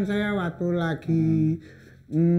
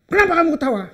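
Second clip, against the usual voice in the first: angry soldier; 10 semitones higher, 5 dB louder, much faster than usual. Retold or imitated. Soldier scolds seller in retold story.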